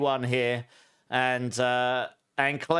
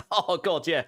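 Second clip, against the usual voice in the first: choked up by wasabi heat; struggling to talk with burning mouth. Real, not acted. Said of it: "can't even speak".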